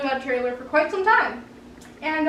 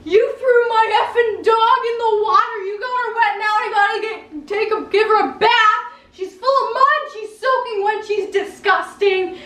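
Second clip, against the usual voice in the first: loud, higher, scolding rant; angry neighbor yelling; 5 semitones higher than usual. Retold or imitated. Neighbor scolding the girl about his dog, loud yelling. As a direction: rail at the kid about the wet dog. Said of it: "he was loud".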